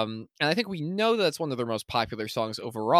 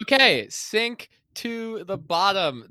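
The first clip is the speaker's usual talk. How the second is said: excited; 9 semitones higher, 5 dB louder, slower than usual. Real, not acted. Announcing the song title.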